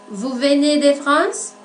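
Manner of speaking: "Vous venez de France?" slow, climbing up to "France"; rising question tune. Shown as a demonstration. Teacher demonstrating a closed question to learners, slow rising tune. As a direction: let the voice rise through "de France" as a question. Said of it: "Elevamos la voz al final de la frase".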